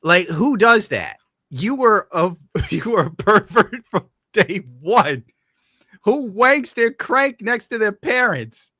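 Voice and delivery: mock horror at a pervert; incredulous outrage. Real, not acted. Streamer reacting to a clip in loud laughing disbelief.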